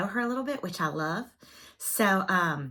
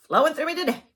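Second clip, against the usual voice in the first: higher and louder, wired burst; caffeine buzz; 8 semitones higher, 6 dB louder, faster than usual. Real, not acted. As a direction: let the caffeine jolt break through. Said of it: "caffeine is".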